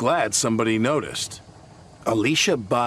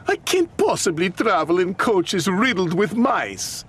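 high, indignant diva complaint; cross; 9 semitones higher, 3 dB louder than usual. A narrator's reading. The singer refuses the mousy coaches, high and cross. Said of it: "Alicia Bhatti was cross".

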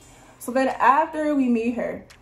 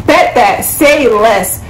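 sudden hyped-up burst of agreement; loud, hyped agreement; 12 dB louder, much slower than usual. Retold or imitated. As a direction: belt it out, much louder. Acting out eager reply to guy.

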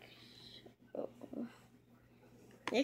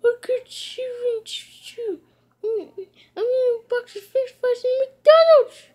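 loud whiny yell; 9 semitones higher, 8 dB louder than usual. Real, not acted. Ranting at the characters.

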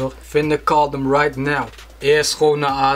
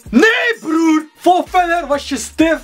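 high, raised-voice protest; indignant outburst; 11 semitones higher, 3 dB louder than usual. Real, not acted. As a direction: shout down the answer indignantly. Answers own questions in rant. (verontwaardigd uitvallen)

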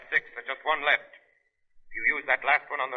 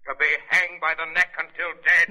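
judge's sentence; 4 semitones higher, 5 dB louder than usual. Retold or imitated. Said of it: "another judge will say".

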